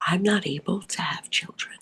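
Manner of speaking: hushed confiding whisper. Retold or imitated. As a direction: lean in and whisper it. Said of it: "I leaned in and I said".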